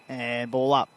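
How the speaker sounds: ordinary talk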